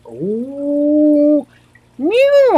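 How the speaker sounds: high playful meow; cat meow. Pretend. Streamer meowing playfully at her chat.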